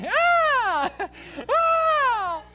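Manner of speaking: high, loud whiny wail, slow; crying child. Pretend. Mock toddler wailing for mommy.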